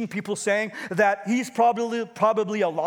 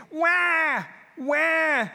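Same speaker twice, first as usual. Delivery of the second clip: mock baby wail; 7 semitones higher than usual. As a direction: cry like a hungry baby. Baby cry again.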